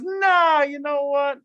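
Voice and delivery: high, drawn-out dismissive Nah; a sly tempter brushing off the warning, pitched high; tempting serpent. Acted. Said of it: "And this thing says".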